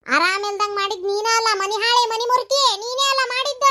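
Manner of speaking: high, shrill accusing outburst; rising furious accusation; angry accusation. Acted. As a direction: hurl the blame at her. Accusing her of wrecking home.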